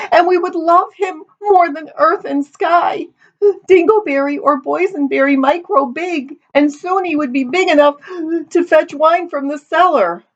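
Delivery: high, hammed-up tearful wail; weeping. A narrator's reading. Daughter imagines her future son to her mother, weeping. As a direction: sob through it in a very high voice. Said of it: "Amity sat weeping".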